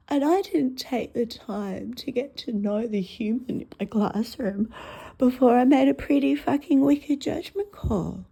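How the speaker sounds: usual speaking voice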